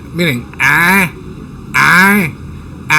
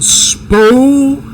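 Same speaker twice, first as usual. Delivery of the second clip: ordinary talk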